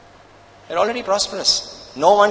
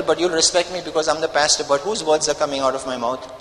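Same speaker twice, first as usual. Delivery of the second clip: ordinary delivery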